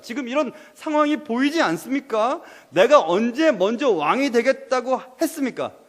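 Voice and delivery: acted anguished outcry; crying out. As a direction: demand answers as a wronged David. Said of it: "하고 지금 다윗은 외치고 있는 겁니다".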